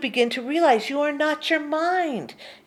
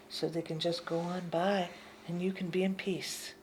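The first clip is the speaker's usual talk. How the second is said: softly whispered peace; hushed whisper of calm; 4 semitones lower, 10 dB quieter than usual. Real, not acted. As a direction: breathe it out in a whisper. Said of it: "teaching calm".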